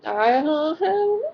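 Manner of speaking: high and slow "I don't know"; drawn-out high unsure. Retold or imitated. Pushed about the date, a slow high hesitant answer. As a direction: stretch it out, squirming. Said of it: "I was like".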